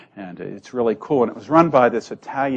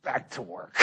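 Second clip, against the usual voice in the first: much softer, curt command; stern order; 13 dB quieter than usual. Retold or imitated. Priest sends worker back with a soft curt order. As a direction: clip it off like the iron fist. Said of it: "kind of friendly, and then there'd be this little back to work".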